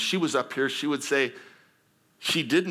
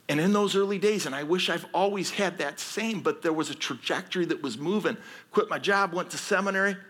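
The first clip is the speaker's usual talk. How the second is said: preaching build; 6 semitones higher than usual. Real, not acted. Preaching at full force.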